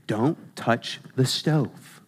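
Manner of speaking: put-on a parent slowly telling a kid no; soft parent warning. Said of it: "Imagine you telling your kid".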